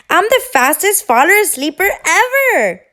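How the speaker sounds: fast, high, loud boast; bragging. A narrator's reading. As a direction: show off, sure she'll win. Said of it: "Thistle bragged".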